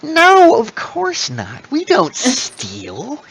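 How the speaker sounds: high, slow innocent denial; kobold queen denying. Acted. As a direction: play it falsely innocent, drawn out. Queen denies stealing.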